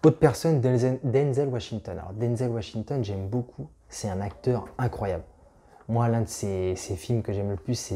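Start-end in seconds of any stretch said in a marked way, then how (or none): none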